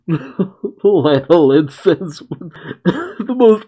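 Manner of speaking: laughing through the words, slowed right down; can't get the line out for giggling; real laughter breaking into the reading. Real, not acted. Laughing while reading the comic.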